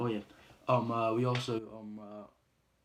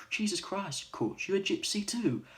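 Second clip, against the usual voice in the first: loud, high incredulous exclamation; acted-out shock, raised high and loud; astonished outburst; 9 semitones higher, 12 dB louder than usual. Boxer to Coach, astonished.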